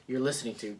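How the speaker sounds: ordinary talk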